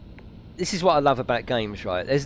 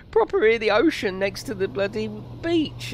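higher, wistful "Proper hear the ocean" as if choking up; mock emotional; 9 semitones higher than usual. Streamer pining for a beach holiday in lockdown, mock emotional. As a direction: let "Proper hear the ocean" swell with hammed-up longing. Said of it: "I'm starting to get emotional".

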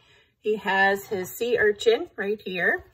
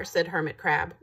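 usual speaking voice

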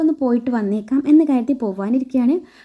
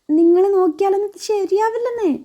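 higher, whiny mock protest; faked pain; 7 semitones higher than usual. Wife stops doctor husband checking her, whiny fake pain. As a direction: whine to dodge his examination. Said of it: "വർഷ അഭിനയിച്ച് തകർക്കുവാണ്".

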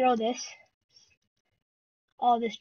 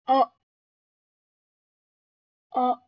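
ordinary talk, nothing marked

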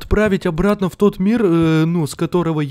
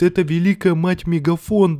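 ordinary talk

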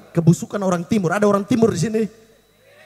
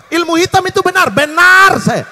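mock proud confession; 7 semitones higher, 5 dB louder than usual. Mock confession shouted to reporters.

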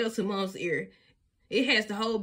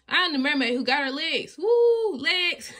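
high, loud whoops of glee; gleeful mermaid play; 6 semitones higher, 6 dB louder than usual. A narrator's reading. Bluey playing mermaid on the sand, loud gleeful whoops. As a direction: show off your brand-new legs.

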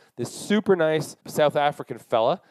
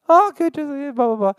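much higher, mock girlish chatter; chirpy girl voice; 13 semitones higher, 5 dB louder than usual. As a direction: squeak it out like the girls answering. Mimicking the girls' chirpy reply.

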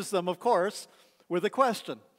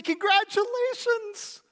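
much higher, louder, slow and beaming; cheering on a friend's engagement; joyful congratulations; 8 semitones higher, 5 dB louder than usual. Pretend. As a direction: celebrate your best friend's news. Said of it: "You say".

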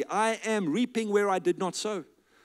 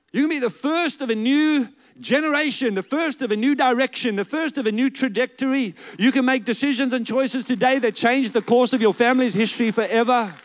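high, loud exhortation with driving repetition; rousing preaching; 5 semitones higher, 6 dB louder than usual. Real, not acted. Preacher urging listeners to change family history, rousing exhortation. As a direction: urge the congregation on at full force.

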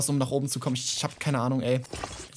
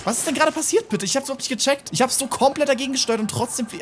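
higher, louder, exasperated ranting; frustrated rant; 7 semitones higher, 4 dB louder than usual. Real, not acted. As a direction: vent at the game, pitched up and loud. Raging after bad steering. (genervt, höher und lauter schimpfend)

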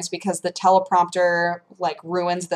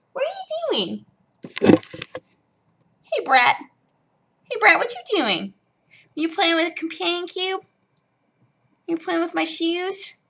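high cooing voice to a pet; pet talk; 10 semitones higher, 4 dB quieter than usual. Real, not acted. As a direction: coo at the cat, high and soft. Talking to the cat.

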